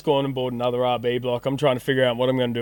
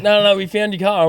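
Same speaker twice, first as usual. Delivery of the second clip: quick, raised and louder; excited insisting; 6 semitones higher, 5 dB louder than usual. Retold or imitated. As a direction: push it urgently. Mate insisting car found.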